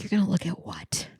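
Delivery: hushed, horrified question; whispering back to the therapist, aghast; alarmed whisper. Retold or imitated. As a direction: whisper it in disbelief. Retold alarmed reply.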